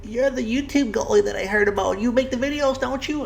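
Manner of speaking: put-on high, frail old lady voice. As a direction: play a sweet elderly woman. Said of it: "in her old lady voice".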